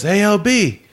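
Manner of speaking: high-pitched mock call to a friend. Chris hailing a friend at the party, calling out. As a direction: call over to get his attention.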